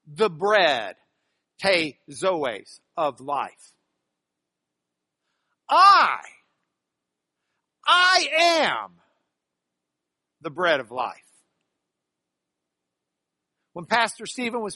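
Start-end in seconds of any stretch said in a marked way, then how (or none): 5.7-8.9 high, loud I, then a strong I am; emphatic I. Shown as a demonstration. Teacher booming Jesus' words "I, I am". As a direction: proclaim the I like a declaration. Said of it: "He's emphasizing who he is".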